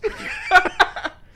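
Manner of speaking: loud high laugh. Real, not acted. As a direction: burst of laughter. Laughing at own whisper joke.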